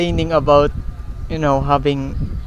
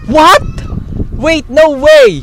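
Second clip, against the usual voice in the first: high, loud stunned yelps; real shock, voice shooting up and loud; shocked shouting; 10 semitones higher, 7 dB louder, much slower than usual. Real, not acted. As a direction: blurt it out in total disbelief. Stunned by a surprise donation.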